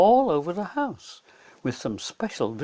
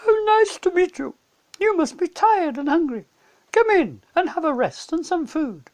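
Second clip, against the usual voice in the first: much higher, louder motherly beaver; Mrs Beaver; 13 semitones higher, 6 dB louder than usual. A narrator's reading. Mrs Beaver welcoming the tired children, high and motherly. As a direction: fuss over guests.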